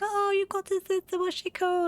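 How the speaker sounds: high, bright mock child voices; excited kids. Classmates asking about the new baby sister, high and excited. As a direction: squeal it like little classmates.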